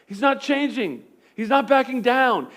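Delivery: rising from plain to loud, high preaching; building preaching. Acted. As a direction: climb to full preaching force on not budging. Preaching builds to full force.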